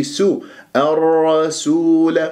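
الرسول slow, with a thin clean s; light sin. Shown as a demonstration. Teacher models the right way, light-sin الرسول. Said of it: "بهذه الطريقة".

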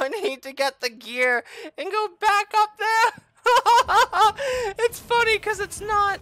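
high, loud laughing through the words; real laugh spilling into high-pitched complaint; despairing laughter. Real, not acted. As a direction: laugh helplessly at your own bad luck. Laughs at setback in game.

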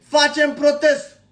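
drawn-out, loud slow shout; defiant protest shout; shouted threat. Real, not acted. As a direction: hammer out the threat word by word.

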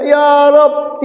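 high mock yell; mildly acted shout to God; crying out. Sinner calling out after thirty years without worship, mock yell. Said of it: "Begitu dia berteriak".

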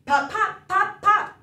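punchy popping sounds; cork popping. Pretend. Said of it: "He can go like a cork".

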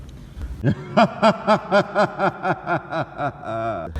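long high evil cackle; villain laugh. Pretend. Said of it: "Hemen bir kötü adam kahkahası atayım".

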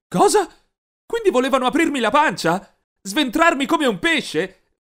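high, loud disbelief; aghast outburst; horrified shock. Acted. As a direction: recoil in horror. Learns they'll cut him open.

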